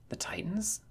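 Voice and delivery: a hushed, breathy whisper of a question. A narrator's reading. Friend anxiously asks the dazed boy, whispered. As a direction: breathe the words, barely voiced.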